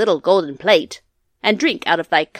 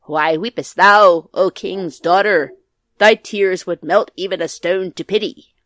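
calling out; 14 dB louder than usual. A narrator's reading. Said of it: "a voice called out".

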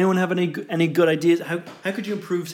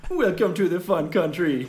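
put-on lilting Jamaican accent, louder; 5 dB louder than usual. [name] answers how a Jamaican would welcome visitors, Jamaican accent. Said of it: "So if you were Jamaican".

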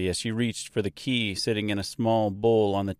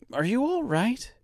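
worried Mister; 13 semitones higher than usual. A narrator's reading. Mister worried, much higher.

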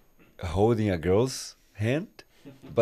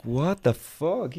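"What the fuck?" drawn out slowly in shock; slow shocked disbelief; 7 semitones higher, much slower than usual. Retold or imitated. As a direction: stretch "What the fuck?" like you can't believe it. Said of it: "And I was like".

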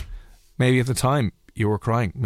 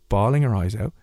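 usual speaking voice

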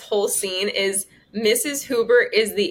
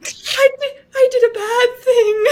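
higher, louder guilty mother, half-laughing; a mother confessing through giggles, pitched up and loud; Susan impression; 9 semitones higher, 4 dB louder than usual. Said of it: "I'm gonna do Susan".

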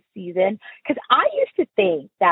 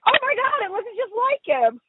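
much higher, squealing mock amazement; gushing girls; 14 semitones higher than usual. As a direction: squeal it like a giddy girl who can't believe the likeness. Girls gush over the painting.

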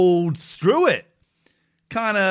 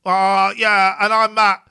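louder, then pitched up high for James; mock contestant voices; 3 semitones higher, 5 dB louder than usual. Host imitating contestants saying their names, hammed up. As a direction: ham up each guy introducing himself. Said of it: "That's how they talk".

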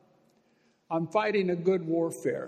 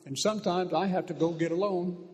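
ordinary talk

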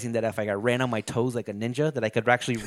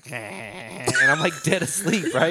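laughing; 4 semitones higher, 3 dB louder, slower than usual. Real, not acted. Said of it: "Dreaming of comedies".

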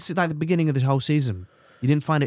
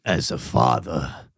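very low, slow patriarch; protective father parody; 6 semitones lower, much slower than usual. Acted. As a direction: intone it like a solemn movie dad.

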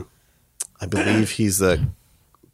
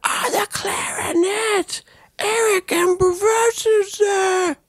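high, drawn-out announcer calling out the band; arena announcer; much slower than usual. Retold or imitated. Host mimics conductor introducing the drummer, stretched high announcer call. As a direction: hype each name like a concert MC.

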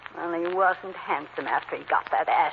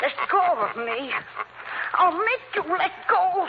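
frantic struggle; 11 semitones higher than usual. Acted. Struggling against the Shadow.

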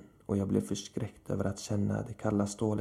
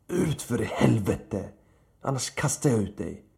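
shouting; 4 dB louder than usual. A narrator's reading. Man yells at the woman to get out, shouting angrily. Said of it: "och jag skrek".

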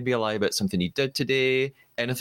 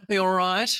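higher, rising mock Aussie; terrible Australian accent; 7 semitones higher than usual. Host checking on guest in a mock Australian accent. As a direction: put on a bad Australian accent. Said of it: "speak in terrible Australian accents".

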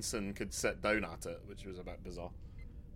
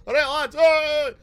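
high, loud lads' shouting; mock rowdy cheer; 11 semitones higher, 10 dB louder, slower than usual. Bringing Wales along with the accents, mock rowdy cheer. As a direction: whoop it up loud and high like one of the lads.

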